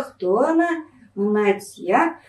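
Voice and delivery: ordinary delivery, nothing marked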